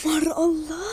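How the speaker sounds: soft breath of adoration; hushed swoon. Acted. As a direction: sigh it out, lovestruck. Swooning over passing boy.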